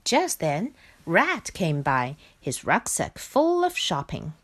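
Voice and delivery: usual speaking voice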